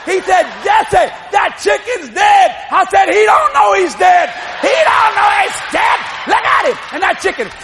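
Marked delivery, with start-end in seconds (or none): 0.6-2.5 retold shout. Grandpa shouts to panicking boy. 3.1-6.8 high, loud frantic protest; panicked mock shout. Terrified boy to laughing grandpa, panicked mock shouting.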